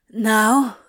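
mildly breathless plea; breathless appeal. A narrator's reading. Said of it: "he appealed breathlessly".

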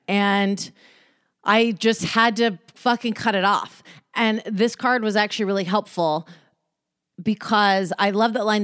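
usual speaking voice

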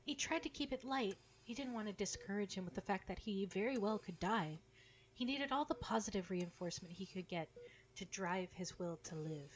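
soft, quiet storytelling voice; story narration. A narrator's reading. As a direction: read it like a quiet bedtime story.

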